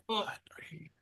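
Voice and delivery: mortified whispered protest; hushed, horrified aside. Retold or imitated. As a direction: whisper it urgently to the boss, barely any voice. Mortified aside to his boss.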